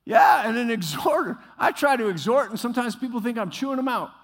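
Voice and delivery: loud, ringing pulpit delivery; full-force preaching. Acted. Pastor preaching to congregation about exhortation, loud and fervent. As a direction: preach it hard to the whole room.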